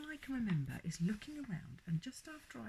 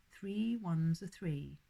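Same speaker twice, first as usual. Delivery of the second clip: slow recitation; 6 dB louder, much slower than usual. Acted. Reciting times table aloud.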